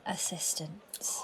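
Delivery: breathless reluctant whisper; guilty hushed whisper. Acted. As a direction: barely breathe the word out. Reluctant answer under interrogation.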